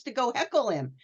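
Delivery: ordinary talk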